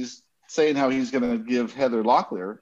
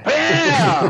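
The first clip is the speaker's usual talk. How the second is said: loud bang; 10 semitones higher, 7 dB louder than usual. Retold or imitated. Door slamming into wall.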